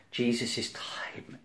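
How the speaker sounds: preacher whispering the suffering; hushed. Acted. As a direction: breathe it out like a secret. Preacher dwells on Jesus' suffering.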